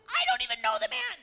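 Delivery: high, loud, rushed protest; desperate denial. Acted. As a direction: blurt it out in desperation. Peter's third denial.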